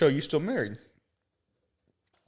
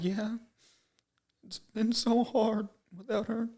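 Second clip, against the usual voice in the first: higher, slow fake-sad sorrow; mock grief; 11 semitones higher, 3 dB quieter, slower than usual. Telling the nosy lady his wife died, hammed-up grief. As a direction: lay on the fake heartbreak for the stranger. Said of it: "you gotta have fun at that point".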